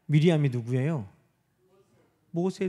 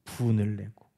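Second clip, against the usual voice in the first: ordinary talk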